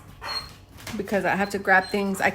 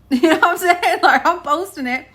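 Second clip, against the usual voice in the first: laughing, higher and louder; 9 semitones higher, 7 dB louder than usual. Real, not acted. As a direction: laugh it out defiantly. Laughing defiance.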